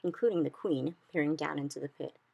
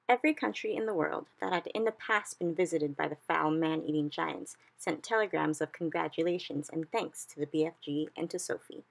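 ordinary delivery, nothing marked

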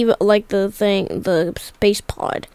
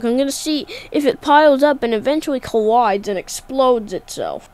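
normal talking voice

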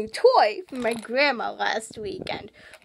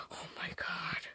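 unvoiced awed whisper; stunned whisper; 7 semitones lower, 20 dB quieter than usual. Real, not acted. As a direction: breathe it out in shock. Stunned after screaming.

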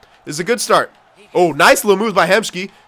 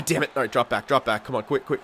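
normal talking voice

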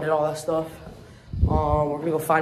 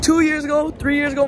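excited; 10 semitones higher, 4 dB louder than usual. Real, not acted. Hyped recalling past.

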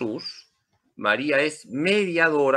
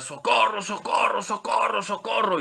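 higher, repeated call of socorro; joking higher-pitched shouts of socorro; mock cry for help; 8 semitones higher than usual. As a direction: playfully act out a man calling for help in the street. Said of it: "comenzó a gritar".

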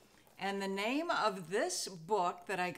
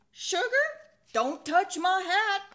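high, louder playful sheriff; sheriff to his wife; 8 semitones higher, 9 dB louder than usual. Acted. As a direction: sing out the warning to his wife. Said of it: "I'm going to be playing two voices".

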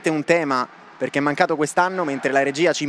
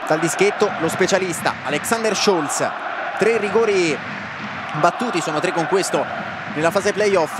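live match commentary; 3 semitones higher, 3 dB quieter than usual. Acted. Penalty call commentary.

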